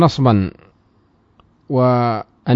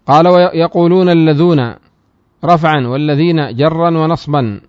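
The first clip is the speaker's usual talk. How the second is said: raised, louder recitation of the source text; reading the text aloud; 3 semitones higher, 5 dB louder, slower than usual. Shown as a demonstration. Teacher reciting the grammar text to students, raised voice. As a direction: recite the matn line for the class.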